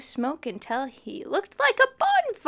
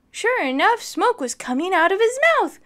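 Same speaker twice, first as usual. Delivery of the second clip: excited narration; 7 semitones higher, 9 dB louder than usual. A narrator's reading.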